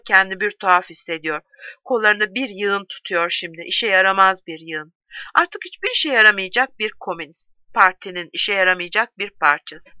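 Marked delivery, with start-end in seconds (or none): none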